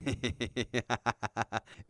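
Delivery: rapid staccato giggle; villain's snicker. Acted. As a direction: snicker tauntingly at your rival. Villain laughs at the hero. (petit ricanement saccadé de méchant)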